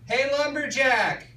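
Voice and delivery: high, drawn-out call across the bar; lady yelling. Retold or imitated. Bar lady calls him over: high mock yell. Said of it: "a lady yell".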